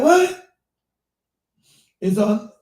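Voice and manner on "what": high, loud startled what; shocked what. Retold or imitated. Hearing the chickens are gone, a high startled what. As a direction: jump up in disbelief.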